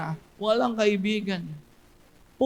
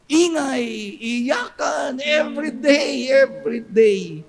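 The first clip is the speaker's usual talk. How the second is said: dramatic wailing emphasis; 4 semitones higher, slower than usual. Acted. Preaching hell's endless wailing.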